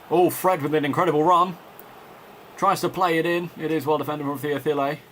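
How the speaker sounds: live play-by-play, caught up in the match; measured broadcast commentator voice; football commentary. Acted. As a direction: call the game like a TV commentator.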